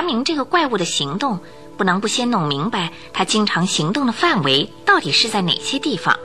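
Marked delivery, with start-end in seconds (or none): none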